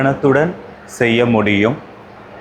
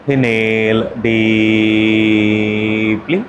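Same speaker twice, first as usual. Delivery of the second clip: very slow, near-chanted on one level tone; sustained monotone with a lift at the end; drawn-out breathing cue; much slower than usual. Shown as a demonstration. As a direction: stretch each word to the length of a long inhale. Paces a long breath before chanting.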